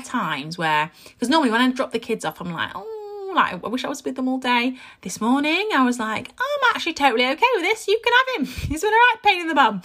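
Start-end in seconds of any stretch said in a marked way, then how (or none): 2.7-4.8 wistful mum. Retold or imitated. Wistful mum dropping kids off. 6.4-9.8 high, brisk mock cheer with a laugh; gleefully relieved mum. Mum gladly handing child over.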